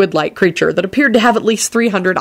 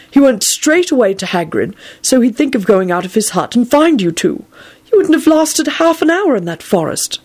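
kindly Dumbledore; 6 semitones higher than usual. Acted.

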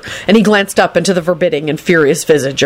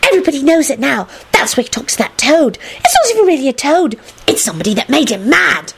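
higher-pitched schoolgirl voice; knowing gossip; 8 semitones higher than usual. A narrator's reading. Girl explaining crazy professor to friends, knowing. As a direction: share the inside story knowingly. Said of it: "hissed knowingly".

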